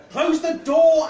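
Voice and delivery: high, loud shouted order; urgent shout. A narrator's reading. Arianwen orders Cyril to shut the door, urgent shout. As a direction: shout it across the room, pitch leaping up. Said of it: "Arianwen shouted".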